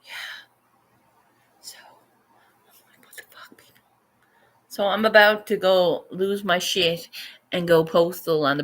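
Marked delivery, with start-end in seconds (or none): 1.6-3.8 whispered what the fuck, under the breath; breathy stunned whisper; exasperated whisper. Real, not acted. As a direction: hiss it out in disbelief.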